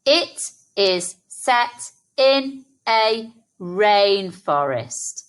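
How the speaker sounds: slow, every word of "It is set in a rainforest" given space; slow read-back. Shown as a demonstration. Teacher reading the sentence back slowly with children. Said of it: "Let's read our sentence back".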